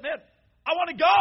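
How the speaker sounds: high, loud cry of longing; eager cry to go home; excited longing. Retold or imitated. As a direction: fling it upward like a yearning shout. Fired-up longing for heaven.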